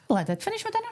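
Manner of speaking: high, indignant protest; argumentative. Real, not acted. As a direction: insist hotly she ate it all. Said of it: "this is how argumentative she gets".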